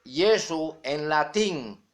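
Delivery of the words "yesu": "Jesús" said slowly as "YEH-soo"; Latin pronunciation. Shown as a demonstration. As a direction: say "Jesús" with a Y and no final s. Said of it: "pronunciando el nombre".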